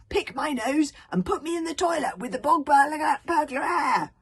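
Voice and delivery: high, loud shouted command; bungled Dragonese. A narrator's reading. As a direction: yell the words at the dragon. Alvin reads Dragonese commands.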